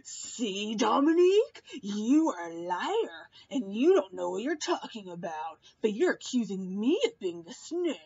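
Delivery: hammed-up Paul calling Dominique a liar; mocking Paul. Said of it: "he was like".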